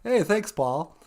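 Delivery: usual speaking voice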